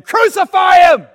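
loud, high yell of an angry crowd; crowd shout. Retold or imitated. The crowd before Pilate demands his execution, loud shouting. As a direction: bellow it as a mob demanding death.

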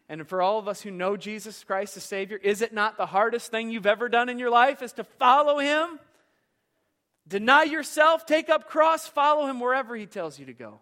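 loud, rising pulpit call; preaching at full force. Acted. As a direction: drive the call home to the congregation. Preaching climax.

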